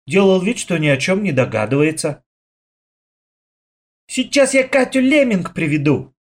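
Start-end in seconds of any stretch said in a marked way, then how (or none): 4.1-6.1 put-on high, eager boyish shout; excited exclamation. A narrator's reading. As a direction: blurt it out with excitement. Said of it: "вдруг воскликнул Лёшка".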